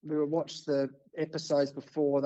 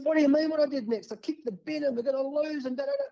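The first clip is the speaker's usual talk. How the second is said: much higher and fast, worked-up protest; exasperated disbelief; 10 semitones higher than usual. Retold or imitated. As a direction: fire it back high and quick, exasperated. Baffled reply to producer.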